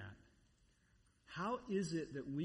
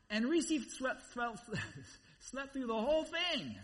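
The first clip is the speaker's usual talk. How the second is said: higher, louder, breaking into laughter; amused disbelief; 8 semitones higher, 4 dB louder than usual. Real, not acted. Husband telling how wife slept through turbulence, laughing incredulously. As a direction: laugh through the exasperated punchline.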